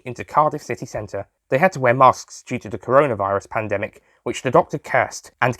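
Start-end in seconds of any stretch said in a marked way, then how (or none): none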